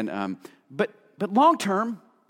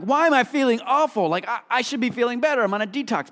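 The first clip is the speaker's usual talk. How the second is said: higher, quick puzzled complaint; lightly hammed-up confusion, pitched up; mock bewildered; 6 semitones higher, faster than usual. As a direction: act baffled that it isn't working. Mock dieter confused by feeling worse.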